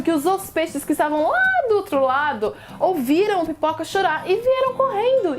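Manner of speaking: ordinary delivery, nothing marked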